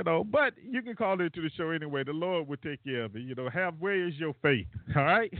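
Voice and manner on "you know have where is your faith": usual speaking voice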